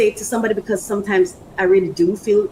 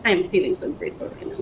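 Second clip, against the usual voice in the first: ordinary talk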